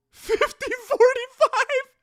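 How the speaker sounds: very high falsetto wail; mock wail. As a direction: whine the score like a crybaby. Mocking wail of score.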